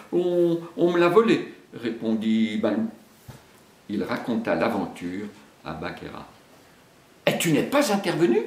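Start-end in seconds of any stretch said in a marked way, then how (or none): 0.1-1.5 higher, stammering excuse; sheepish bear. Acted. Ashamed Baloo admits Mowgli was stolen, stammering. 7.3-8.4 higher, quick reproach; reproachful. Acted. Bagheera reproaches Baloo, quick and sharp. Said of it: "demanda la panthère".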